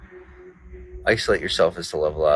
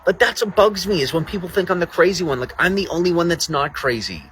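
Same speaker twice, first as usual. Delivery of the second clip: indignant outburst; 10 semitones higher than usual. Real, not acted. Indignant rant at chat.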